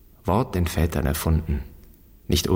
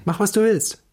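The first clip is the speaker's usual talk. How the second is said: much higher, sing-song mock of a lax parent; 13 semitones higher than usual. Son mimicking the anti-authoritarian father's advice, mocking and high. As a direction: parody the permissive father's shrug of a phrase. Son mocks the permissive upbringing.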